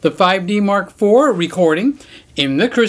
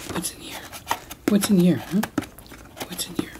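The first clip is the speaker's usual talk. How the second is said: much softer, secretive teasing; hushed; 8 dB quieter, faster than usual. Real, not acted. Teasing the dog about the box, hushed secretive voice. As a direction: hush it like a secret surprise.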